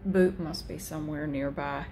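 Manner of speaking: normal talking voice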